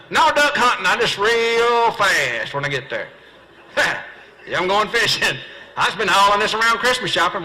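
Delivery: sarcastic comeback. Retold or imitated. Snapping back at the gas station clerk, hammed-up sarcasm. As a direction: pile on the sarcasm, enjoying it. Sarcastic reply to obvious question.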